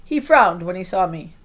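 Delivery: plain reading voice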